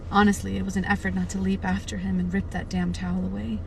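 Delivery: ordinary delivery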